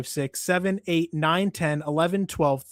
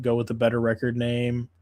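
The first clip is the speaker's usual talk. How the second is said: normal talking voice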